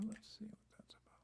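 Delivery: unvoiced whisper while peering at the screen; whisper to self. Real, not acted. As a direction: breathe it out under your breath, barely there. Checking frozen screen, talking to self.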